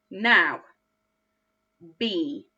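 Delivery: ordinary talk, nothing marked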